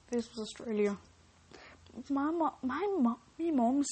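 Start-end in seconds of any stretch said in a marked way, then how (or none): 2.1-3.9 failed attempt at an Australian accent, pitched up; shaky-start Australian accent, abandoned midway; bad Australian accent. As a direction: fumble your way into an Australian accent. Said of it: "I can't do an Australian accent".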